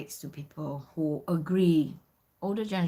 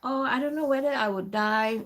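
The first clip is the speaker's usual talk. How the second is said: complaining; 6 semitones higher than usual. Retold or imitated. Customer complaining about too-sweet cake, in mock dismay. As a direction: imitate a customer moaning about food. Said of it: "she started to complain. She said".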